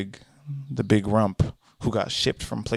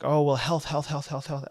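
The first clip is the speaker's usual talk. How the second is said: mocking critics; 3 semitones higher than usual. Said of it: "I know people are gonna be like".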